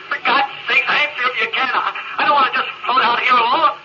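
ordinary talk

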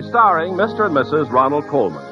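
usual speaking voice

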